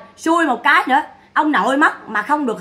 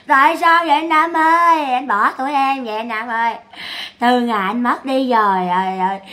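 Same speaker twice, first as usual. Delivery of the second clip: mock lament. Imitating Sáu mourning her brother, mock lament. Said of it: "Sáu này chạy Sáu khóc quá khóc luôn".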